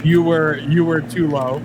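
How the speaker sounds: usual speaking voice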